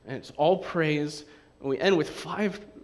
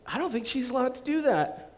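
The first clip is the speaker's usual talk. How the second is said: mock scandalized; 9 semitones higher than usual. Mocking churchgoers shocked by Naomi's complaint, prim. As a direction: pitch jumped way up in prim disapproval. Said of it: "And we're like".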